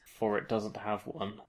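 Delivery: slow, hushed reveal; ominous hush. A narrator's reading. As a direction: drop to a slow, quiet dread. Spooky reveal in narration.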